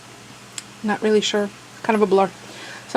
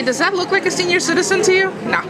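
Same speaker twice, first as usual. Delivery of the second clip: playful teasing; 9 semitones higher, faster than usual. Real, not acted. Teasing about parents being seniors.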